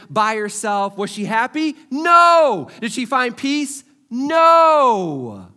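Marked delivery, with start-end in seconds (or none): 1.9-2.7 a sudden loud, higher no; emphatic shouted no. Real, not acted. Pastor answering his own question to the crowd with a loud no. 4.1-5.5 a sudden loud, higher no; emphatic shouted no. Real, not acted. Pastor answering his own question to the crowd with a loud no.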